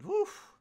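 squeaky high exclamation; sharp high woof. Real, not acted. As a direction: yelp it out high. Exclaiming at the heat.

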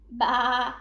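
loud, drawn-out "baa"; sheep bleat. A narrator's reading. Farm animals heard from next door, sheep bleat. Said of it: "happy sounds of the animals".

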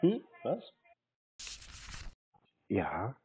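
usual speaking voice

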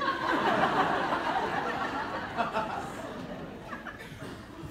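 long breathy high laughter; laughing hard, high and airy. Real, not acted. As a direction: dissolve into giggles. Laughing at the story.

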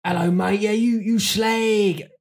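slow, drawn-out South London "you slag"; hammed-up South London geezer; South London accent. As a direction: lay on the cockney banter. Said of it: "deep south, South London".

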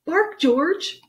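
high, loud command; insistent mother. Acted. Mother dog telling George to try, insistent. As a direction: order the pup firmly. Mother orders pup to bark.

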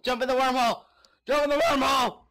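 high, loud eager yelling; excited shout. Real, not acted. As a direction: cheer yourself on loudly. Urging himself into wormhole.

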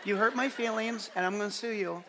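small, soft put-on voice; mock hurt weakling. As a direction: play a wounded little complainer, quietly. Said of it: "I still hear you talking like this".

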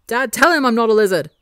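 high, loud begging to dad; loud pleading. A narrator's reading. Wilbur running to Dad, a loud high plea. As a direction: whine the plea at full volume. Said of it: "he pleads".

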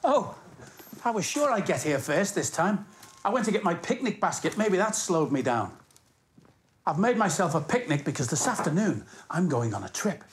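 ordinary delivery

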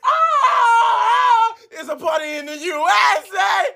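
usual speaking voice

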